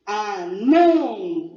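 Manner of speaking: "Ah, não" as one word, NÃO long and loud; stress on last syllable. Shown as a demonstration. Teacher showing the stronger sound, "Ah, não" with long loud NÃO. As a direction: stretch and punch the final NÃO in "Ah, não". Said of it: "Olha o som mais forte".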